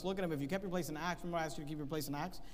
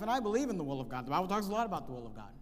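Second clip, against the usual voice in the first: usual speaking voice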